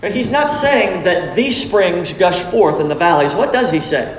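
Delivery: ordinary talk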